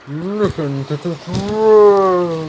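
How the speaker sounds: very loud and very slow; drawn-out shout. Pretend. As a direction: stretch it into a huge bellow.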